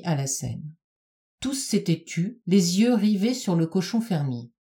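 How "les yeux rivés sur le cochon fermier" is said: ordinary delivery, nothing marked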